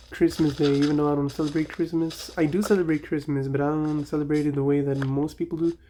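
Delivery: ordinary talk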